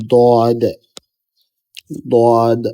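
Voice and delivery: "দদ" drawn out, both tongue edges against upper molars; emphatic Arabic Dad in "দদ", said slowly and separately; two-sided Dad. Shown as a demonstration. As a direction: model a heavy, held "দদ" with the tongue on both sides. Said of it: "আমার উচ্চারণটা এরকম হচ্ছে, আমি দুই দিকে লাগিয়ে".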